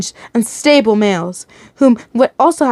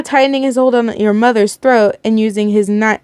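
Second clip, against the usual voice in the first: ordinary delivery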